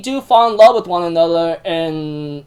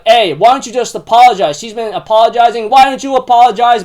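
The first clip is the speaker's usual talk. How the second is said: loud, raised-pitch angry demand; Haru snapping; 5 semitones higher, 5 dB louder than usual. Retold or imitated. Retelling Haru demanding an apology from parents, snapping. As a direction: snap at them to apologize, voice pushed up. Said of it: "Haru was really snapping out at them".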